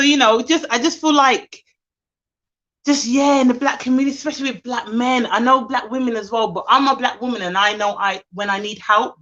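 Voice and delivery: usual speaking voice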